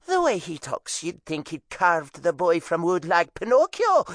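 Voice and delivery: high mocking Irish mother; scornful Irish mom; Irish accent. A narrator's reading. Mom to tourists.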